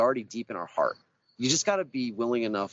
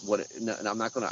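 normal talking voice

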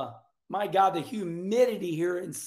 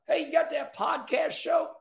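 mock caller shout; 7 semitones higher than usual. Listeners calling out to host.

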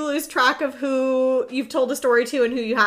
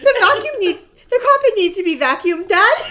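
much higher, louder cutesy dog voice with a laugh; nagging Dad through the dog; Penny voice; 7 semitones higher, 7 dB louder than usual. Pretend. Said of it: "as if it's coming from Penny".

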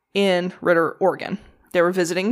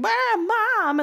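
put-on whiny kids; 14 semitones higher than usual. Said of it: "the two older boys are like".

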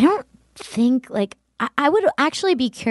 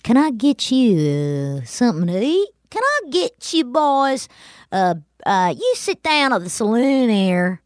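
saloon keeper. Acted. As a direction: play a Western saloon hostess. Playing saloon keeper in Old West.

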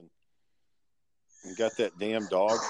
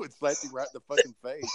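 ordinary talk

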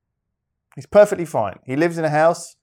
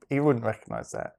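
ordinary talk, nothing marked

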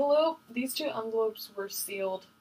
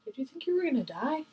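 normal talking voice

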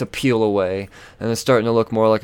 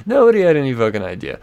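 louder, fast exasperated burst; frustrated; 5 semitones higher, 7 dB louder, faster than usual. Real, not acted. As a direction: vent it, fed up. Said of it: "I was frustrated".

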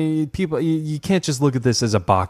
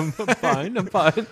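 put-on high, laughing nervous kid voice; 9 semitones higher, slower than usual. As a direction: insist you're fine like a scared boy. Imitating scared boy denying fear.

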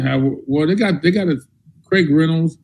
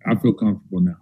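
lower, softer, relaxed player voice; imitating a settled player; 5 semitones lower, 4 dB quieter than usual. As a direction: say it as a young back who finally feels at ease. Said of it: "they go".

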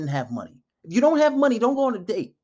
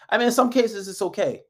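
ordinary talk, nothing marked

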